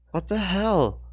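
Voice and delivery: high, loud shout of shock; yell. A narrator's reading. Rachel yells at the swordswoman in shock. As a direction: yell in disbelief. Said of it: "she yelled to the woman".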